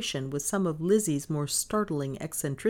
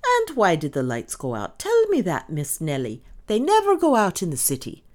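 louder, pointed demand; stubborn challenge; 5 semitones higher, 6 dB louder than usual. A narrator's reading. Maid challenging mistress about the lights, insistent. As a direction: press her for an answer.

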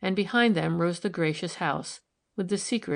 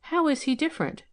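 curious; 9 semitones higher, faster than usual. A narrator's reading. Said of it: "she asked curiously".

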